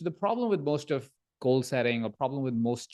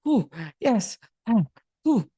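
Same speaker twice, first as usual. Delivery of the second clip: winded; 7 semitones higher, 4 dB louder than usual. Retold or imitated. Dad out of breath at park.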